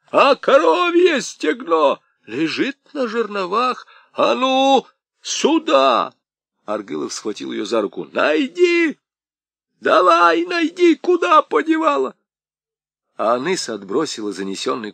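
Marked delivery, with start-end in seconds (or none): 0.1-6.1 high angry demand; angrily demanding, raised voice. A narrator's reading. Old man demands angrily. 8.1-12.1 high furious yell; angry shout. A narrator's reading. Old man grabs wife, demands.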